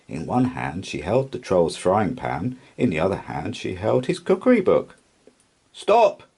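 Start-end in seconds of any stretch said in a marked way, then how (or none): 5.7-6.2 high, loud sharp command; commanding cry. A narrator's reading. Pirate chief halting the plank walk, loud commanding cry. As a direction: call a halt at once. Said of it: "cried Hank Chief".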